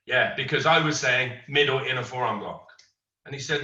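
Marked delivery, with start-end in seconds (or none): none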